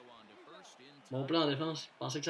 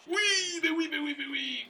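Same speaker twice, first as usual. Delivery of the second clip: excited cheer; 13 semitones higher, 3 dB louder than usual. Real, not acted. Celebrating a play.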